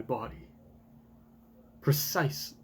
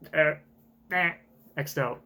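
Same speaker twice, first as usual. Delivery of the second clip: usual speaking voice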